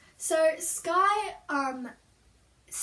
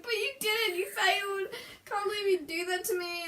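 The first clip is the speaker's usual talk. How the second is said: higher-pitched mock-hurt protest; whiny accusing; 6 semitones higher than usual. Dreamer to mum who failed to protect her, whiny accusing. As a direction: complain as if betrayed.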